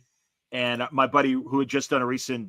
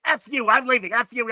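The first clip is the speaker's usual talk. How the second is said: high, loud mock tantrum; disgusted outburst; 10 semitones higher, 5 dB louder than usual. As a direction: imitate a fed-up man storming off yelling. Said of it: "he gets disgusted with me".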